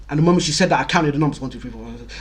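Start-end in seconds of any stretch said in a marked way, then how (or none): 1.4-2.1 counting under his breath, much softer; tally the seats in a hushed murmur; quiet counting. Retold or imitated. Nervous student silently counts the circle, hushed murmur.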